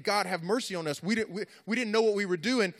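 ordinary talk, nothing marked

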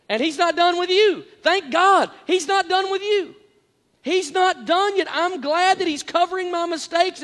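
high, ringing preacher's cry; preaching. Acted. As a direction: lift the congregation with rising fervour. Preaching rises to full force.

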